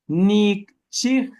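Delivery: Nikchihki slowed, with an audible break at the h; word with a cut. Shown as a demonstration. Teacher models the broken form so students hear the cut. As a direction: make the cut in Nikchihki clear. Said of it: "Hay un corte".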